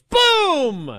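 a big high shouted Boom. Retold or imitated. Host imitates the wrestler's one-word catchphrase, high mock shout. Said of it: "And A J goes".

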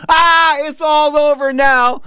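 despairing. Retold or imitated. Inner thought, gun pointed at him.

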